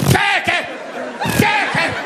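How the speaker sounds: high-pitched explosive sneeze; imitated sneezes. Driver sneezing six times in a row, high explosive sneezes. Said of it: "six times in a row".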